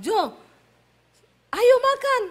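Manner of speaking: high, loud Sulawesi-style holler; loud summons. Retold or imitated. Wife calling her husband to eat, high loud holler. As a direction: call him to the table at full voice. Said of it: "Ya, saya panggil".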